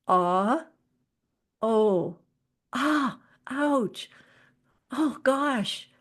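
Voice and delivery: little empathic cries of ouch and gosh; vocal outbursts. Shown as a demonstration. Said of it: "sounds like this".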